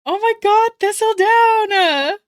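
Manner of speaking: high, loud and slow with delight; overjoyed. Real, not acted. Streamer thanking the fan artist, high loud gushing delight. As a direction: gush at the gift. Excited over the 3D Baxter.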